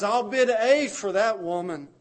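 loud and high, calling over the crowd; urgent bid. Acted. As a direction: Hosea fighting to win her, called out. Hosea bidding for Gomer.

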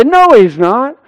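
loud, high retort, imitating an indignant husband; retold protest. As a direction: protest loudly as the husband in the story. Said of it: "Martin Luther would say".

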